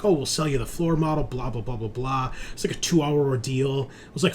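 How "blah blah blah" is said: ordinary talk, nothing marked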